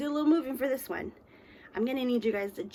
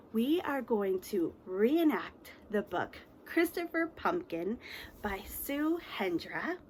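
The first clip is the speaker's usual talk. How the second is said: ordinary talk, nothing marked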